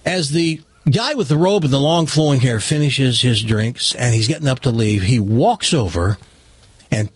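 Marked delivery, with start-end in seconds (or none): none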